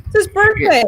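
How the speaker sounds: real shock that he still came to work; incredulous. Real, not acted. Host scolding cohost for working on son's birthday, incredulous.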